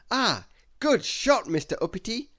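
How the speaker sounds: loud cheery golf praise; cheerful praise. Acted. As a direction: congratulate a fellow golfer brightly. Mister Happy praises shot.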